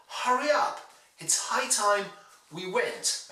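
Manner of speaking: 'Hurry' and 'high' with strong initial H, pitched high; practise-sentence delivery, breathy H on 'Hurry' and 'high'; sounded H's. Shown as a demonstration. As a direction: model each H clearly in 'Hurry up' and 'high time'. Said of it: "Repeat words with H's in them".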